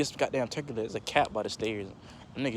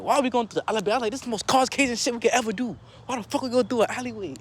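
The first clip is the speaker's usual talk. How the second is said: high, insistent retold complaint; alarmed protest; 9 semitones higher, 3 dB louder, faster than usual. Cousin protests going through the alleyway, high alarmed complaint. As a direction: act out objecting, pitched up high.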